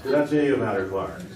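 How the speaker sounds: normal talking voice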